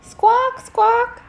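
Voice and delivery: put-on a parrot squawking back at a boy. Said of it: "The bird".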